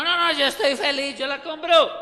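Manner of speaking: high, loud, happy offer; acted eager volunteer. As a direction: play the pal gladly treating everyone. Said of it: "hasta aparecía alguno".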